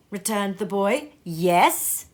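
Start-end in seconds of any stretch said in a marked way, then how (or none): 1.2-2.1 gleeful. A narrator's reading. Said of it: "brimful of glee".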